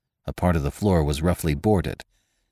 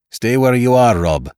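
firm louder warning; cautioning; 5 semitones higher, 7 dB louder than usual. A narrator's reading. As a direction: warn him to stay put. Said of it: "cautioned the doctor".

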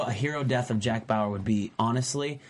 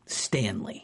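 whisper; 5 semitones lower, 5 dB quieter than usual. Acted. Punchline about a dull Office character.

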